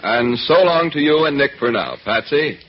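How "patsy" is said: usual speaking voice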